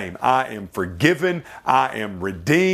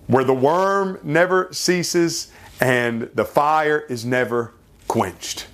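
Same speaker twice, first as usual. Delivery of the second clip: ordinary delivery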